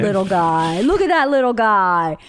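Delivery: put-on lightly cooing, as if to a baby; baby-talk mommy. Wife re-enacting how she teases her husband, lightly cooed baby talk. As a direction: coo it in mild mock baby talk. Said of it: "sort of like baby mommying you".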